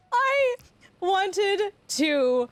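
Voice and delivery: slow, spaced-out words falling from high to normal; mortified. Real, not acted. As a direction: draw out each word, sliding down in pitch. Recounts mortification after laughing fit.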